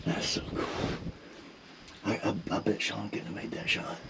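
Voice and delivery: hushed, very soft admiration; low-volume whispered praise; whispering. Real, not acted. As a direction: whisper it in the blind. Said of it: "we're whispering now".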